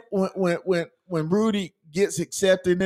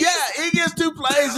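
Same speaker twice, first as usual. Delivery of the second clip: excited; 9 semitones higher than usual. Real, not acted. Excited sports recap.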